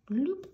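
a high, bright bloop. Real, not acted. Tutor voicing the finished R stroke with a high bloop. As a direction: pop the word out high like a bubble. Sound effect for pen stroke.

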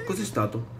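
usual speaking voice

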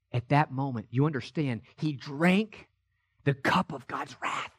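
soft and low, almost to himself; a low, soft hush on the cup of wrath. Acted. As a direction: draw them in with a hush. Hushed retelling of the crucifixion.

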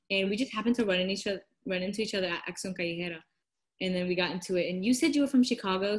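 ordinary talk

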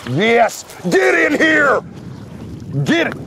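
high, loud triumphant whoop; excited yell. Real, not acted. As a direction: yell it high, cheering the catch in. Excited yell on hooking a fish.

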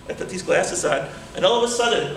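ordinary delivery

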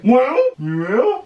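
very high, slow squeal; hopeful rat. Shown as a demonstration. Rat hears cheese is coming, hopeful squeal. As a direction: squeal the question slowly.

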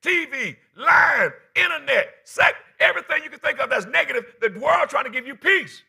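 shouted list, building; full-force preaching. Acted.

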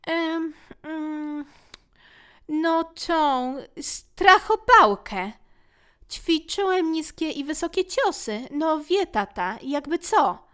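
put-on high, hesitant boy stalling slowly; flustered child voice; nervous boy. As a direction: cover up a secret with a shaky excuse.

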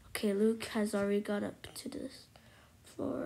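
hushed whispered aside. Real, not acted. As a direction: whisper the update to yourself. Quiet aside while playing.

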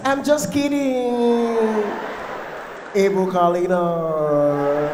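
loud, long-drawn singsong; hammed-up woman's voice covering a slip; mock feminine cover-up. Man covers slip with female name.

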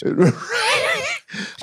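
sudden high shout of laughter. Real, not acted. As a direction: burst out laughing. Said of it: "But our teeth look like a mosh pit".

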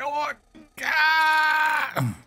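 overacted cry of agony; hammed-up agonized scream. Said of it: "that was terrible acting".

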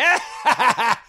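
loud, high mock drunk laugh; high, raucous imitation guffaw; drunken cackle. Drunk dad's laugh, acted out.

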